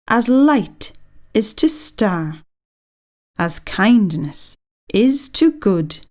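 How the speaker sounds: put-on a teacher reading a poem aloud to children, slow and measured. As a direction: recite each line slowly and deliberately.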